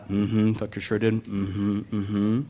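flat, low, grudging mumble; stoic denial. Retold or imitated. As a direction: mutter it like you're swallowing every feeling. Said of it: "The stoic denial of emotions".

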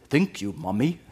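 lightly imitated Ugandan accent; fond imitation of a child's Ugandan English. Three-year-old daughter thanking her mom, light Ugandan accent. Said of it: "in that deep Ugandan accent".

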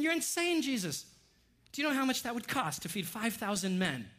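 high-pitched, incredulous outburst; acted-out indignant objection, pitch jumping up; offended protest. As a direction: protest as if the request is crazy. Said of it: "the disciples get a little offended".

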